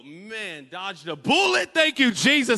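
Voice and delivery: loud mock preacher cry of relief; hammed-up praise shout; relieved shout. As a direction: shout your thanks like you just escaped.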